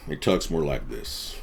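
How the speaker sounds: low, slow cowboy drawl. Shown as a demonstration. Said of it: "he's more like a cowboy".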